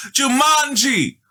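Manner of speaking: high, loud drawn-out yell. A narrator's reading. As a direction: scream the word as loud as she can. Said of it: "she yelled as loud as she could".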